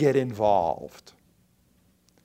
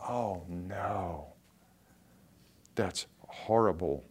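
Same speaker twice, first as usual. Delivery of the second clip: soft, low lament, acted out; hushed, sinking voice of mock-up repentance; imitated remorse; 5 semitones lower, 5 dB quieter, slower than usual. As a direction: act quietly stricken with regret. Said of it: "he wept. He repented".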